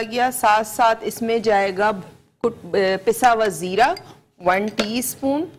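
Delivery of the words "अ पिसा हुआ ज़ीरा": usual speaking voice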